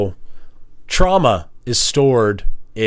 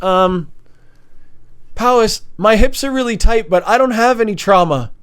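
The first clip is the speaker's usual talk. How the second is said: higher, bubbly mock teen girl; mock teen girl protest, higher; imitating teen girls; 10 semitones higher than usual. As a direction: play the giggly girls on the team. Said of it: "they're all bouncing, thinking, like".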